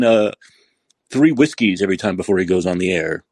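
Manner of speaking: normal talking voice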